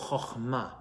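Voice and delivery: chokhmah with a throaty kh at the start; Hebrew word. Shown as a demonstration. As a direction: say chokhmah as XAX-ma, guttural kh. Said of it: "the Hebrew word, my friends, for wisdom is".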